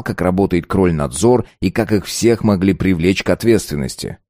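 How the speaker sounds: ordinary talk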